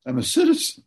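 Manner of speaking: mock-defiant quote, rising to a high, loud "citizen"; defiant late wife. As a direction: toss it off like nobody can touch her. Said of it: "She kept telling me".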